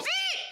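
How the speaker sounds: very high squeak like a stalling car. Retold or imitated. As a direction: imitate the car's squeal. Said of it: "it goes".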